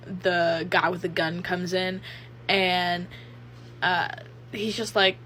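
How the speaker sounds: ordinary talk, nothing marked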